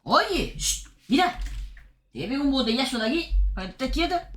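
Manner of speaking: mock threat to a naughty cat; scolding a pet. Real, not acted. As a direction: warn the cat to keep still.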